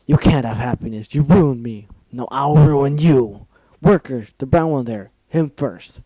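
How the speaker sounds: loud, raging shout at the filly; furious yell. Acted. Villain ordering workers to seize the brown pony, furious yell. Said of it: "yelled with more fury".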